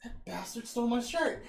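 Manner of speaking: high, loud indignant cry; mock outrage. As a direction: rage about the stolen shirt, hammed. [name] outraged at drone.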